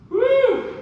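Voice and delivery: high, loud whoop; woo yell. Real, not acted. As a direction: let out a big whoop. Yell after a hard set.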